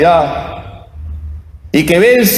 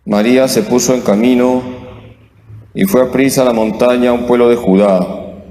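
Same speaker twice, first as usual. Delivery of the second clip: measured scripture reading, lower; gospel reading; 7 semitones lower than usual. Real, not acted. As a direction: read the gospel solemnly from the pulpit. Priest reads the gospel aloud.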